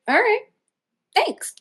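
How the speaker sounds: high little retold reply; lightly squeaked acceptance and thanks; meek thanks. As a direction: pitch it way up, meekly agreeing.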